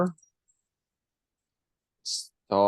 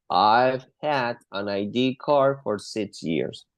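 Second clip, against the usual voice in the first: slow, careful full sentence; slow model answer; slower than usual. Shown as a demonstration. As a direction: model it slowly for the class. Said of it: "me puede contestar toda la oración completa".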